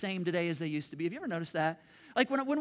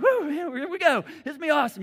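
high, loud eager whoop; thrilled rider before the drop; excited kid; 6 semitones higher, 9 dB louder, faster than usual. Retold or imitated. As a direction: kid on the coaster, higher and louder. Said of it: "I'm like".

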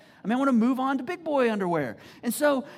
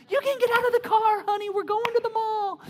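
put-on much higher, sing-song baby talk to a seven-year-old; mock coddling mom; 12 semitones higher, 4 dB louder than usual. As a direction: coo at him like a toddler. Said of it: "she's like".

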